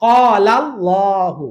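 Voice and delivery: কলাল্লাহু very slow, thick dark L; slow recited কলাল্লাহু; heavy L in Qalallahu. Shown as a demonstration. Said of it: "লামের জবর help করেছে".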